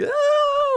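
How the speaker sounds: very high excited squeal. Retold or imitated. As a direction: squeal like a thrilled fan. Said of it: "like a kind of a thing".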